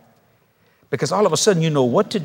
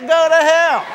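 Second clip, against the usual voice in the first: pretend verdict; 11 semitones higher, 4 dB louder than usual. Voicing Jesus' verdict.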